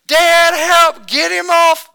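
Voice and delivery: high, loud imitation of a child screaming in pain; retold panicked scream. Pastor imitating his son screaming for dad, high panicked yell. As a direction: yell for dad like a boy pinned by a steer. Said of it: "He started screaming".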